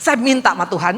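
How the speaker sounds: usual speaking voice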